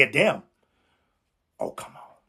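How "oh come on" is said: breathy whisper, nearly silent; whispered aside. Real, not acted. Preacher whispering to his listeners, softly. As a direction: whisper it to the congregation.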